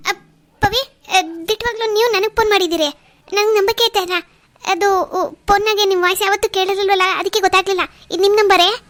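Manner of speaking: can't believe she called; thrilled groom. Acted. Groom on the phone to his bride, loud delighted excitement.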